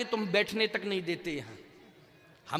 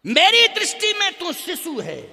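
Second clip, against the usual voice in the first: loud, high motherly insistence; mother's emphatic declaration; 7 semitones higher, 7 dB louder than usual. Acted. Kaushalya to her son Ram, high emphatic insistence.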